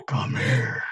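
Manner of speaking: playful snarling wolf voice; mock werewolf snarl. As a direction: growl it lightly, like a wolf on the hunt. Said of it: "just howling and snarling".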